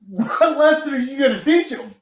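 laughing while voicing the puzzled dad; amused mock disbelief; laughing mock question. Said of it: "And they're like".

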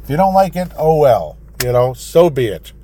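defiant. Real, not acted. Host daring offended listeners, loud and defiant. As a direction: shrug off the offended loudly.

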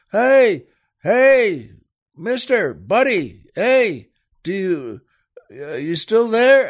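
high, loud searching calls; calling out. Acted. Husband calling out into the rain for a stranger, calling out. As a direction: call into the dark for someone. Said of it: "calls out".

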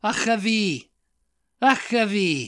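"Achavi" with a throaty ch and long final ee; Welsh pronunciation. Shown as a demonstration. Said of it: "pronunciation of it right by next to it".

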